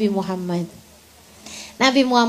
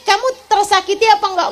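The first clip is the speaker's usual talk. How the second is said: laughing question; 7 semitones higher, faster than usual. Retold or imitated. Asks the wife, laughing.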